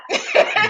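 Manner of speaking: a short, high burst of laughter; laughing at her own mistake; real laugh. Real, not acted. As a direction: laugh at yourself.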